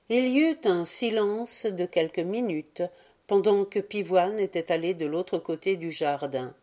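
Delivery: plain reading voice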